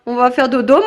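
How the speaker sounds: usual speaking voice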